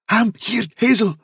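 high, strangled gasp; choking gasp. A narrator's reading. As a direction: gasp it out in fright. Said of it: "in a kind of choking gasp".

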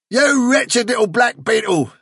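high-pitched furious insult. A narrator's reading. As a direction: round on him in fury. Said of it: "turned furiously on Fiver".

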